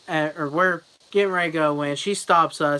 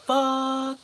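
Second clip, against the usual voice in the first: high, stretched-out mock dismay; dismayed drawn-out curse; 9 semitones higher than usual. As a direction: draw the curse out high in dread. Dreading the woman who approaches.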